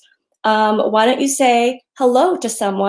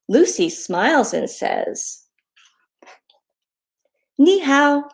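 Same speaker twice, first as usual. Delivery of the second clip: put-on reading to children; 3 semitones higher than usual. A narrator's reading. Author reads her hello book to children, friendly read-aloud.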